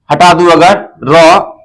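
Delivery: ordinary delivery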